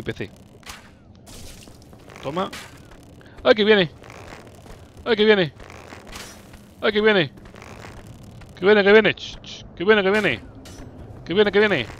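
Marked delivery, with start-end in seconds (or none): 3.4-9.1 pánico fingido. Pretend. El enemigo se acerca. 9.8-11.9 pánico fingido. Pretend. El enemigo se acerca.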